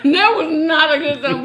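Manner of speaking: loud laughing protest. Real, not acted. Laughing over bad thumbnail.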